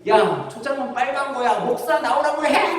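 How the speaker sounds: high-pitched drunk imitation; rowdy drunkard. Drunk still calling out the pastor, rowdy. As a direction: build into a comic drunken demand. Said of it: "술 취해갖고 이렇게 행패를".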